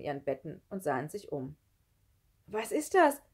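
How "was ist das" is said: high, loud startled question; calling out. A narrator's reading. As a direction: cry out on waking with a fright. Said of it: "rief Sami". (laut und hoch gerufen)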